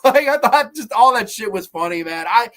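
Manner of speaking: normal talking voice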